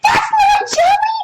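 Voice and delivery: put-on very high, loud child protest; kid protesting. Shown as a demonstration. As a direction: object like an indignant kid. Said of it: "some of my kids are gonna be like".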